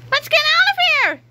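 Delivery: high, loud cry to flee; urgent cry. Acted. George urging Harold to flee, high frantic cry. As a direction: shout it in sudden alarm. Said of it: "he cried".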